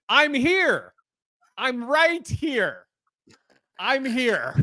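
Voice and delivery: high, drawn-out imitation of an eerie call; calling to the ceiling. Man imitating his date addressing the ceiling, high drawn-out call. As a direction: announce yourself slowly to unseen listeners above. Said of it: "looks up at the ceiling and goes".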